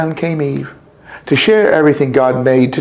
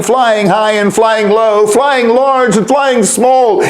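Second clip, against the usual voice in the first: plain reading voice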